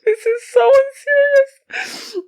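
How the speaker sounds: ordinary delivery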